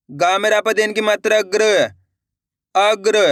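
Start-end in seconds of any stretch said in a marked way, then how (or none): none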